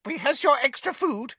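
high, hurried elf voice; squeaking elf. Acted. Elf hurrying Harry out, squeaky and brisk. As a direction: squeak it while pushing food at him. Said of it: "squeaked an elf at Harry's elbow".

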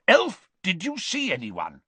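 high, loud, demanding question; impatient. A narrator's reading. Diggory questioning the elf, impatient. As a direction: demand an answer. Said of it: "said Mister Diggory impatiently".

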